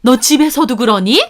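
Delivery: high, loud indignant tirade; furious mother-in-law. A narrator's reading. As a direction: scold the daughter-in-law in outrage. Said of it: "그 말에 어머님 거품 물더군요". (거품 무는 시어머니)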